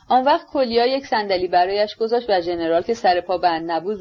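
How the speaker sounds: ordinary delivery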